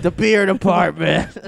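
slow, laughing through the words; laughing mock caller. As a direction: drag out the beer department while cracking up. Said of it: "Be like".

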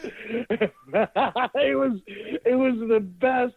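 words squeezed out through high laughter; laughing. Real, not acted. Host recalling the funny night, speaking through laughter. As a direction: still laughing, slow and high.

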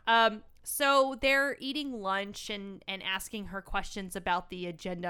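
normal talking voice

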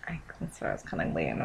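fast hushed whisper. Real, not acted. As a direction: whisper back conspiratorially.

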